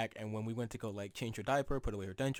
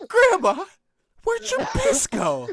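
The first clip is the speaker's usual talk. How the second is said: very high, loud, drawn-out demand; hammed-up incredulous yell; mock shouting; 11 dB louder, slower than usual. Grandson to grandma over the missing piss, hammed-up shout.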